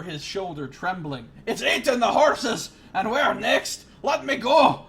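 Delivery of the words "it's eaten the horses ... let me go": much higher, loud frantic shouting; terrified yell. Acted. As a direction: blurt the horror out. Elf yelling in terror.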